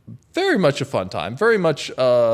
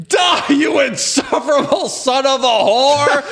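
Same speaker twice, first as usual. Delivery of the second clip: much higher, louder, slow hammed-up shout; furious shout; 11 semitones higher, 4 dB louder than usual. Man torching the monkey in an alley, roaring. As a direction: roar it like a gleeful killer. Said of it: "blowtorch saying".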